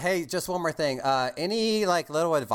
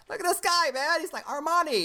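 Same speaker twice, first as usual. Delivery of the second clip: much higher, a playful gush of admiration; impressed gushing; 13 semitones higher than usual. Retold or imitated. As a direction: gush over the guy like he's a model. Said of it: "I'm like".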